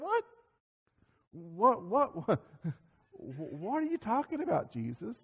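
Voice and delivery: high, soft, stammered incredulity; baffled disbelief. Acted. Followers questioning Jesus about rejection, baffled. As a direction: act out a puzzled follower, voice climbing then dropping.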